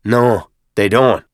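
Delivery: slow, loud character snarl; drawn-out sergeant's snarl. A narrator's reading. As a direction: snarl each word with disdain. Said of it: "Byrne snarled".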